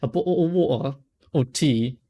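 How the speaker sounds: clipped British "A bottle of water or tea?" with dropped t's, "bo'l o' wa'er"; a playful British accent on "bottle"; mock British accent. Shown as a demonstration. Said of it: "I'm gonna say it like you want to say it".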